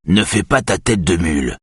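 plain reading voice

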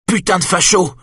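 very high yelled curse; screaming insult. Acted. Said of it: "hurla-t-elle".

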